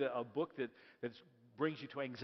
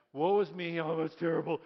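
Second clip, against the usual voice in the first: loud, hammed-up woe; mock lament; 8 dB louder than usual. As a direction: wail it like a martyr. Joseph voiced lamenting.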